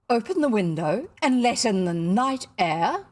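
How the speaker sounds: loud, incredulous question; scandalized exclamation. A narrator's reading. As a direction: echo the suggestion in loud outrage. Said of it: "she exclaimed".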